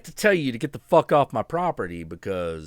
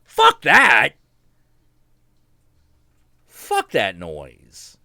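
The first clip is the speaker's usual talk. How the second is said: sudden loud angry curse; angry outburst; 2 semitones lower, 9 dB louder, much slower than usual. Real, not acted. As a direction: bark it out in disgust. Outburst against trolls.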